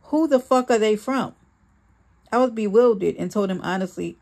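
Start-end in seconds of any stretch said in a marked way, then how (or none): 0.0-1.3 mild yell, pitched up at the question; restrained narrated shouting; angry yell. A narrator's reading. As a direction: demand an answer, voice raised a little. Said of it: "Sean yelled".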